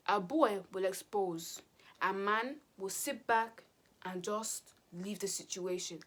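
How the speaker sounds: slow deliberate lecture; preachy. Retold or imitated. Telling her sister how a man behaves, slow and preachy. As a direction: spell out the lesson slowly.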